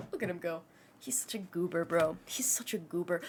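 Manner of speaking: ordinary talk, nothing marked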